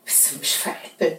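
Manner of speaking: leise ungläubige Frage; flüsternd nachfragen; geflüstert. Real, not acted. (leise geflüstert)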